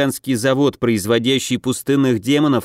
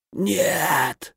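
coughing out a word; 2 semitones higher, 6 dB quieter than usual. A narrator's reading. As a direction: cry choked by a cough. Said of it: "закашлялся одарённый".

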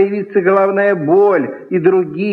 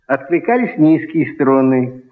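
ordinary delivery

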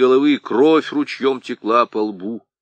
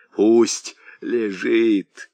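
hoarse rasp; much slower than usual. Acted. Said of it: "прохрипел Аргылов".

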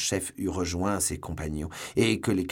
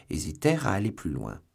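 plain reading voice

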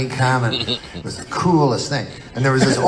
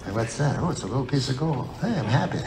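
small, quiet wonder; 10 dB quieter, faster than usual. Acted. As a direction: barely dare to voice a tiny discovery. Tiny find of gold acted out.

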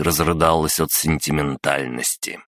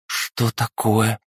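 put-on confused girl; 7 semitones higher, slower than usual. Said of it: "растерянно оглянулась".

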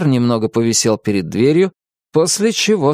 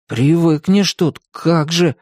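grumbling; 6 semitones higher, slower than usual. A narrator's reading. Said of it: "пробурчал Тим".

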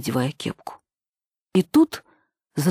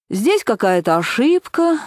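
higher, slow, drawn-out boredom; 6 semitones higher, 4 dB louder, slower than usual. A narrator's reading. Nina brushes off the caller, bored voice. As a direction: drag it out with boredom. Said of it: "скучным голосом".

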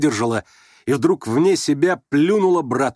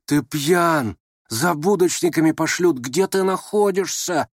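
voiced, higher-pitched urgent warning; alarmed hiss to a drunk friend; urgent whisper; 6 semitones higher, slower than usual. A narrator's reading. Ptitsyn warning drunken Rogozhin of police, urgent alarm. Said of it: "прошептал вдруг Птицын".

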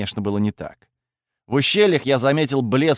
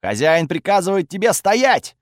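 loud command; 8 semitones higher, 4 dB louder than usual. A narrator's reading. Master commands the god.

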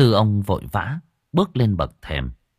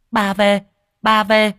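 much higher, louder happy cry; excited child cheer; 12 semitones higher, 5 dB louder, slower than usual. Acted. Granddaughter cheers grandma home, much higher excited voice. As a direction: squeal it like a delighted little girl. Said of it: "reo lên rối rít".

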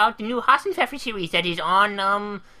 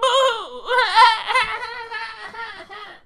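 mock sobbing laugh. Going off to pout.

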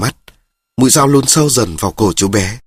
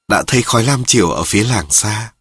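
ordinary delivery

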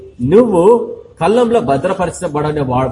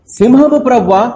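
much higher, slower cry of alarm; watchman crying out; 7 semitones higher, 3 dB louder than usual. Retold or imitated. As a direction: stretch it out as a warning call from the tower. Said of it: "అని అరుస్తున్నాడు". (కావలివాడి పెద్ద కేక)

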